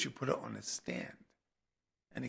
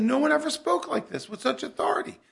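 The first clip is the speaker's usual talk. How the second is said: high, amazed exclaim of the listening crowd; astonished crowd; 12 semitones higher, 6 dB louder than usual. Retold or imitated. Preacher voicing the astonished crowd, high and amazed. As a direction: voice the stunned crowd in wonder. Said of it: "And they said".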